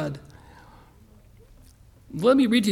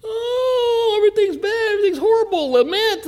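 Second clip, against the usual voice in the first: hammed-up wail of woe, pitched way up; mock lamenting; 13 semitones higher, 4 dB louder than usual. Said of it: "now what's a lamentation?".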